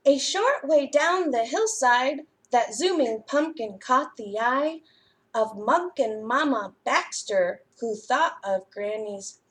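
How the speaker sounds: lively, expressive storytime read-aloud; reading to children. A narrator's reading. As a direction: read it like a librarian to little kids. Reads picture book to children.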